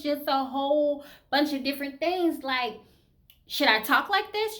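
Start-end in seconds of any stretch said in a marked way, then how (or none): 3.5-4.6 higher, louder questioning voice; trying another voice. Shown as a demonstration. Woman wondering how she should talk, higher voice. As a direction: try on a higher voice. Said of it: "do I have another voice?".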